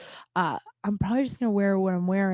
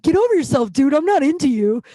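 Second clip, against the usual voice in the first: higher, louder mock rebuff; loud brush-off; 7 semitones higher, 9 dB louder than usual. Telling the guy from the rave off, loud and dismissive. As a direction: shoot him down emphatically.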